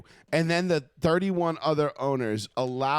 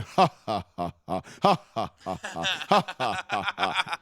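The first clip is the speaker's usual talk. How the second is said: mocking laugh; 3 semitones higher, slower than usual. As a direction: fake a smug mocking laugh. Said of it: "sit there going".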